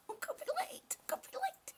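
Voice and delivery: high breathless whispered panic; panicked whisper. Retold or imitated. Retelling oversleeping, a laughing panicked whisper. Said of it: "I'm like".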